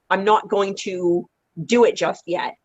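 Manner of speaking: ordinary talk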